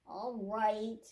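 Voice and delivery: ordinary delivery, nothing marked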